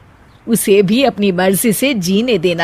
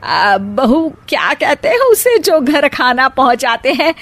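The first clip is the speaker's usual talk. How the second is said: normal talking voice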